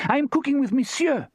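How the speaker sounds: put-on high, French-accented and emphatic; emphatic French woman. A narrator's reading. Therese sending Jake away, emphatic French accent. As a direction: shoo him off in a French accent. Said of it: "she said emphatically".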